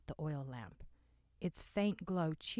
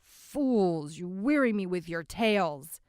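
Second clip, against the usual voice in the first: scornful weary man; 3 semitones higher, 12 dB louder than usual. Acted.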